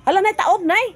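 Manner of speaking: loud high startled cry; sudden alarm at the water; loud high alarm at the rising tide. Real, not acted.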